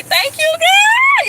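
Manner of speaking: excited thanks. Real, not acted. Thanking a viewer for the biggest super chat, delighted squeal.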